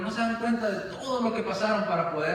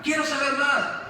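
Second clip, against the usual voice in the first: much higher, rushed and impatient; impatient student begging his master for more; desperate impatient boy; 7 semitones higher than usual. Acted. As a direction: whine like a teen who wants more now. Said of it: "Y el muchacho desesperado".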